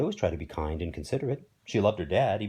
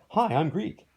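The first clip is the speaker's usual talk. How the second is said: lightly perky, higher-pitched hello; higher mock-friendly quote; mock greeting; 8 semitones higher, 4 dB louder, slower than usual. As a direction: cheerfully introduce yourself, pitched up a little.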